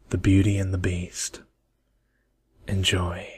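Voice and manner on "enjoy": soft whispered enjoy; whispered sign-off. Real, not acted. Whispering enjoy to listeners before the story. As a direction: breathe it as a whisper. ASMR reading about to start.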